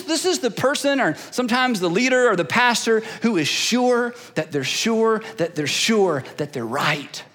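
normal talking voice

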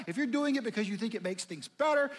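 ordinary talk

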